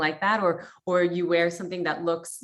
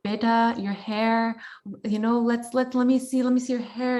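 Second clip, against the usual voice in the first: imitating mom; 5 semitones higher than usual. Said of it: "My mom would be like".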